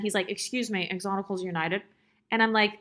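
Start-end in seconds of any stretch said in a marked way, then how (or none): 0.3-1.8 softer, lightly mimicked nerdy sitcom voice; Urkel. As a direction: imitate a coworker's little Urkel-like accent, kept low and soft. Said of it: "he says it in his, like, little Urkel accent".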